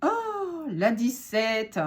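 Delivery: oh aigu et montant, puis la dix-sept lente; s'étonner joyeusement de retomber sur cette carte; surprise ravie. Real, not acted. Tire une carte, s'exclame. (exclamation ravie et étonnée)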